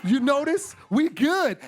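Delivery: loud, cocky hey, we coming out; swaggering call. Retold or imitated. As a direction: strut out of the fire bragging. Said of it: "they'd be like".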